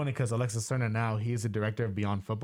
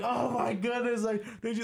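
ecstatic celebration; 11 semitones higher than usual. Retold or imitated. Celebrating with strangers in the theater, high elated gush. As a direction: gush like you just saw a miracle.

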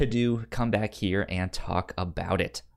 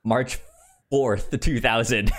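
ordinary talk

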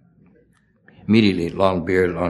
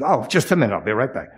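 directress; 2 semitones higher, faster than usual. Retold or imitated. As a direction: hurry off as the directress, pitched up. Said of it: "She said".

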